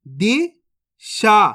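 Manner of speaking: Disha stretched very slowly, each sound held; slow teaching demo; drawn out slowly. Shown as a demonstration. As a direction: spell out Disha slowly for a child. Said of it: "अब चलिए आगे देखिए".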